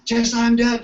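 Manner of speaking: grandmother's plea. Retold or imitated. Grandmother urging the skeptical daughter, loud and high. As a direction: push it like a bossy grandmother. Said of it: "grandmother, says".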